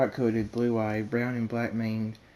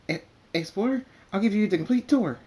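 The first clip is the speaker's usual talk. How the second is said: high, bubbly, fast-talking party pony; excitable Pinkie Pie; 10 semitones higher, 7 dB louder than usual. A narrator's reading. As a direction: gush like you can't wait to throw a party. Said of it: "Pinkie Pie says".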